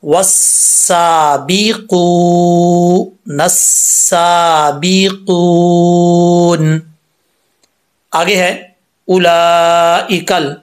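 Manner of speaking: drawn-out melodic recitation; tajweed practice recitation; slow Quranic recitation. Shown as a demonstration. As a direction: recite slowly for students to repeat. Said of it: "अब आपने मेरे साथ practice करनी है।".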